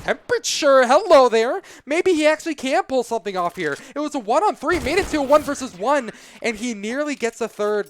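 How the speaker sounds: loud, high hype call; excited. Real, not acted. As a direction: erupt as the clutch unfolds. Clutch attempt, caster erupts.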